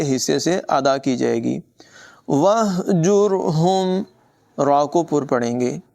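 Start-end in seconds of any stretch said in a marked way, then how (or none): 2.3-4.0 slow, higher melodic tajweed chant; Quran recitation. Shown as a demonstration. Teacher recites the verse for students, slow melodic chant. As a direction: chant each word long and flowing.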